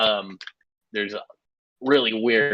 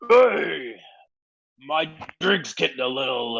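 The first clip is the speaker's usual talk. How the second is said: put-on drunken orc; 6 semitones higher than usual. Orc to a passerby mistaken for help, acted complaint. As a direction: play an orc grumbling about his drink.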